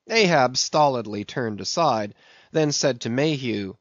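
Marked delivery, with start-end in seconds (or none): none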